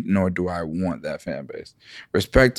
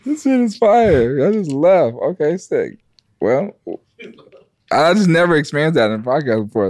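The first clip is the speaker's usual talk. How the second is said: excited; 6 semitones higher, 4 dB louder than usual. Real, not acted. Reacting to friends leaving room.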